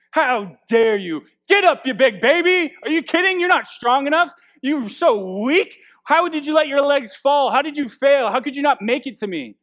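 hammed-up furious parent yelling; harsh scolding parent. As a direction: berate the fallen child at full blast. Mock harsh parent scolding fallen child.

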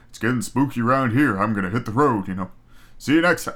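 [name] impression. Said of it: "And [name]'s like".

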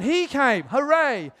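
loud, high hooray; playful cheering. Pretend. Speaker celebrating the visitor to the congregation, loud cheering.

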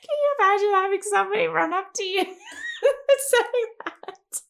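laughing disbelief. Real, not acted.

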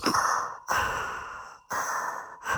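imitated mask breathing, harsh airy rasps; Darth Vader noisemaker. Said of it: "if you press a button, it goes".

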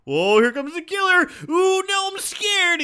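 high, loud fake fright with no conviction; mock scared actor. Bad actor faking fear of the killer, high and hammed. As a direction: ham up a terrified victim who doesn't care. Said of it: "It's like".

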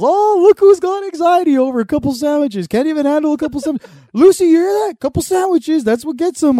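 sneering dad impression, fast on "Can't even handle"; mocking dad. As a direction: needle your son like a jeering father. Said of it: "He goes".

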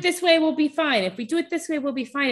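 high-pitched imitation of insistent partisans; voiced extremists. Speaker mimicking extremists on both ends, insistent. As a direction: pitch it right up, voicing each side's simple promise.

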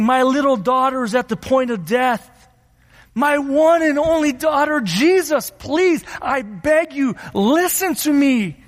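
high, urgent desperate plea; father imploring for help; earnest begging. Acted. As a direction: beg with rising desperation, pitch lifted. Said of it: "he implored him earnestly, begged Jesus".

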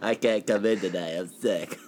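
low, quiet sick-day mumble; teen calling in sick. Retold or imitated. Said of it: "teenager calling out from work".

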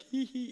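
high imitated "hee hee"; toddler giggle. Daughter smiling back at her laughing dad, high mock giggle. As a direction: mimic a cheeky little girl's giggle.

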